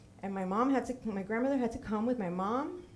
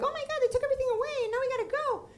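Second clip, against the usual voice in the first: high, fast mock lament of the rich family; mock rich relatives; much faster than usual. The speaker mocks her rich Cuban family, high and fast. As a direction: squeal it like the spoiled rich relatives whining. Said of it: "And they were like".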